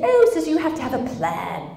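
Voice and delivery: hammed-up British accent; bad British accent. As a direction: imitate a British lad, badly. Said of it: "That's my bad British accent".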